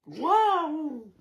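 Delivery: loud, drawn-out waouh; excited wow. Shown as a demonstration. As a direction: belt out the waouh. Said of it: "On peut dire deux waouh".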